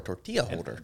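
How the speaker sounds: tortilla said with a Spanish lilt; Spanish accent. Real, not acted. Explaining what they asked for, in a Spanish accent. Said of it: "Why do you say it like that?".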